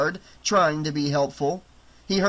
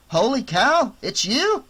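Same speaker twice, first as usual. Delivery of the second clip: louder, higher amazed exclamation; surprised; 8 semitones higher, 5 dB louder than usual. Acted. Boy spots the girl and exclaims, louder and higher. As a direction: react to seeing her.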